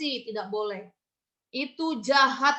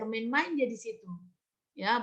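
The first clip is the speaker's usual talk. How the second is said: ordinary delivery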